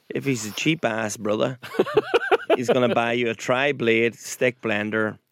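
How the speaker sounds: usual speaking voice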